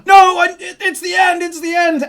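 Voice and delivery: high, loud desperate wail; panicked protest. Acted. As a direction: cry out in frantic protest. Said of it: "He's there like".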